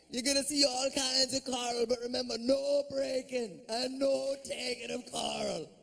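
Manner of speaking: a Jamaican guide laying down the rules; Jamaican tour guide. Retold or imitated. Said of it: "he goes".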